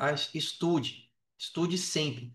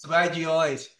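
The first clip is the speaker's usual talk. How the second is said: louder, half-joking threat to the group; teasing loud warning; mock threat; 6 dB louder than usual. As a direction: scold the fans in fun, voice raised. Playful threat to cut the group.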